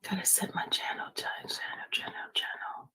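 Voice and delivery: unvoiced whisper to herself. Real, not acted. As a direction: whisper it while busy. Repeated while setting up stream.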